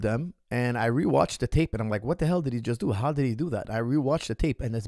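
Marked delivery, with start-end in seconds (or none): none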